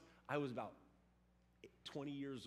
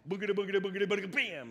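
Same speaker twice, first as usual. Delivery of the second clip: louder running noise ending in a bam; hamster crash; 5 semitones higher, 11 dB louder, much faster than usual. Retold or imitated. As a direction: build the chant, then slam into the wall. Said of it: "hamster stops when he falls out".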